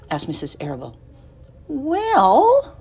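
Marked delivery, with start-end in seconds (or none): 1.7-2.8 high, drawn-out pondering; musing, rising hesitation; thoughtful. Acted. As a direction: stretch it out while thinking. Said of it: "said Fern thoughtfully".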